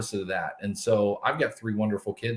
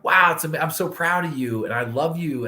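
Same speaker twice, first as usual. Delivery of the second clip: retold gushing praise, higher and louder; proud praise; 7 semitones higher, 3 dB louder than usual. Dad retelling praise for straight A's, proudly gushing. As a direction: gush like a proud parent, retelling it.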